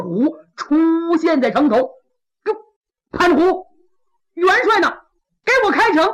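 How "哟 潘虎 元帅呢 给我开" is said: calling up to the city wall, raised and higher. Acted. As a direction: shout the order up to the wall. General calls up to wall.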